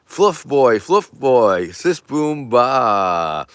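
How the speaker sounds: loud, drawn-out cheerleader chant; playful rah-rah holler; mock cheer. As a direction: cheer it out, stretching the last syllable.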